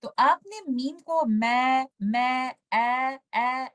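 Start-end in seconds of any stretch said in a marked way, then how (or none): 2.7-3.7 ए as in apple, short and open; apple sound. Shown as a demonstration. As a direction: show the vowel ए as in apple. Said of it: "एप्पल sound पे पढ़ना है". (ए, एप्पल वाली आवाज़)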